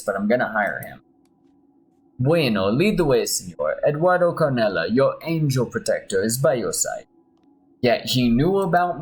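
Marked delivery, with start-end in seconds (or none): 2.2-7.1 put-on a light Spanish accent, grand and proud; mild Spanish-accented promise; gentle put-on Spanish accent. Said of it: "my Spanish accent".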